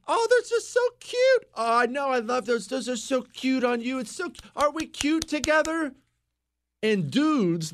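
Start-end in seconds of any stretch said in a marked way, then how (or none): 0.1-5.9 put-on high, gushing girly voice; squealing mock praise of shoes; mocking gushing women. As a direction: ham up the fawning compliments. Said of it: "they encourage each other".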